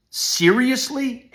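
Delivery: "seriously" drawn out slow and pitched far up; stretched emphasis. Real, not acted. Father telling the school to take it seriously, drawn-out high "seriously". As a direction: lift "seriously" high and hold it long. Stresses seriously, glosses it next line.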